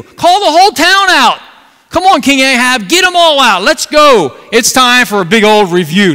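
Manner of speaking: high, loud fiery preaching; rally the whole town to the contest; loud revival-style call to action; preaching at full force. Acted.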